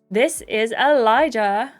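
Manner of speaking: normal talking voice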